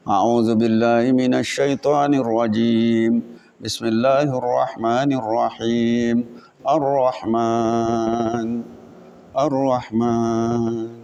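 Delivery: low, very slow, soft reciting; slow drowsy recitation. Retold or imitated. Imitating the slow but sure student reciting until he nods off, slow and soft. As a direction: drag each word out as if half asleep.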